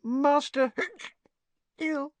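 high, tipsy house elf; a wobbly, squeaky little elf. A narrator's reading. Winky hearing master ill.

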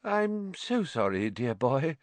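airy, high, slow apology; just waking from a trance; dreamy. A narrator's reading. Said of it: "she said dreamily".

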